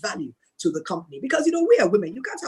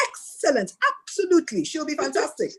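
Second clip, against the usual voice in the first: eager agreement; 8 semitones higher than usual. Retold or imitated. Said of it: "and I said".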